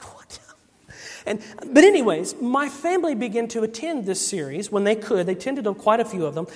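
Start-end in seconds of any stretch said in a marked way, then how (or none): 0.0-0.4 very soft, whispered disbelief; acted hushed bewilderment; stunned whisper. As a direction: mouth it in a baffled whisper. Stunned kid reacting to letdown.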